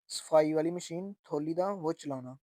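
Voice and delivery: normal talking voice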